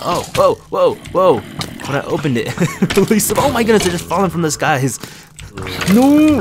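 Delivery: high, loud yelps of surprise; startled alarm. Real, not acted. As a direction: cry out as the trap springs. Startled by falling zombies in game.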